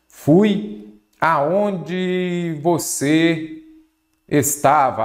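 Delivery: very slow, words drawn out with pauses; slow dictation. Shown as a demonstration. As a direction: stretch each word as if writing it down. Dictates example while writing it.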